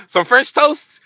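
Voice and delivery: high, loud mock-eager question; excited girl impression. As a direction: ask it in a hopeful falsetto. Imitating her excitement over food.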